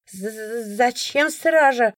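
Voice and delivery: slow, halting, freezing question; shivering stammer. A narrator's reading. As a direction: stretch the first sound through chattering cold. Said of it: "Холод пробирал до костей".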